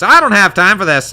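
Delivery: high, loud brush-off in character; impatient character. Acted. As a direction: snap it out as an impatient prince. Chosen option acted in character.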